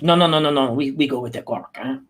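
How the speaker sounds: light mock French accent; dismissive, imitated French speaker. French winemakers insisting on corks, mock French accent. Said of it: "but the French".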